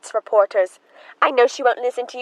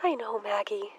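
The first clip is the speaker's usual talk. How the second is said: hushed; 9 dB quieter than usual. Acted. Emma hushing maid about her sister.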